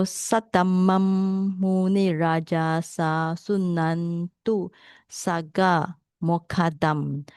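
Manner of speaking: Satamam Muni Raja Sa Sunan tu Saga Mokadam read very slowly, syllable by syllable; slow Pali recitation. Shown as a demonstration. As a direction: spell out the Pali words slowly. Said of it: "that's how we pronounce".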